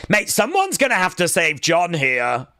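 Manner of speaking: ordinary talk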